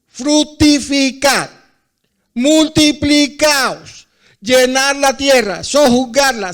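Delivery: loud, high, slow scripture proclamation; preaching at full force. Real, not acted. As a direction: proclaim every command to the crowd. Preaching at full force, quoting Genesis.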